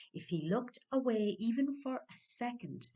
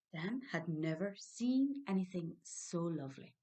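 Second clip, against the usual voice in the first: plain reading voice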